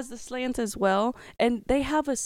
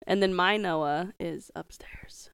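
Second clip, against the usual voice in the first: whispering; 3 semitones lower, 3 dB quieter than usual. Real, not acted. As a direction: whisper so nobody upstairs hears. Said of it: "Not say his name too loud".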